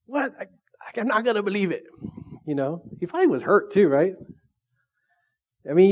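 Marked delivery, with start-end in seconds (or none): 0.1-1.8 mock upset Thomas.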